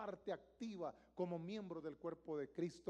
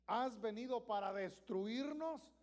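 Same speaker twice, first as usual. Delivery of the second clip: slow, louder demon question; slow raised demonic question; demon asking; 5 semitones higher, 5 dB louder, slower than usual. Acted. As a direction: press the question like a cornered spirit. Demon voiced again.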